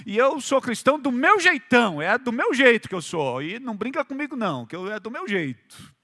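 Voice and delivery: normal talking voice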